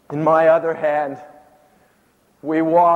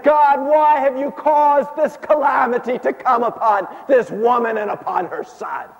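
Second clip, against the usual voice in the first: raised pleading cry upward; crying out to God; 7 semitones higher than usual. Acted. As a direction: lift the question to heaven. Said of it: "I lifted my voice heavenward".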